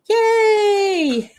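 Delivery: loud, high drawn-out yay; real delighted cheer. Real, not acted. Streamer cheering at getting the channel name, delighted. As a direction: cheer loudly as it clicks.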